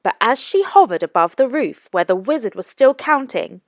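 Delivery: plain reading voice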